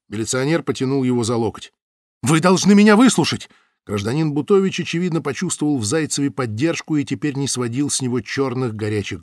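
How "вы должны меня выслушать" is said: high, loud and agitated. Acted. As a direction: press them, worked up. Butovich pulled away by militiaman.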